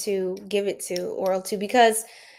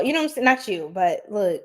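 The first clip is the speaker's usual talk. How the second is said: ordinary delivery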